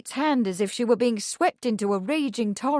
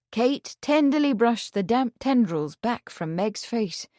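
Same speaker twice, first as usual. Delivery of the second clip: plain reading voice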